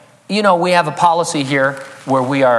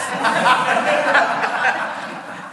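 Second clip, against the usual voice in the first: breathy laugh; 13 semitones higher, 3 dB quieter than usual. Real, not acted. Laughing at own joke.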